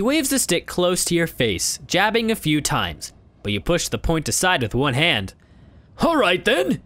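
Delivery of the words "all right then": put-on declaring old beggar. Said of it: "he declares".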